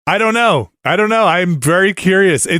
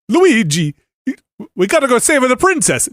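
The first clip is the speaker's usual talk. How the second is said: higher Italian-style Mario impression; full-on Mario voice; 9 semitones higher than usual. Shown as a demonstration. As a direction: ham up the cartoon Italian plumber. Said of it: "the full-on Charles Martinet".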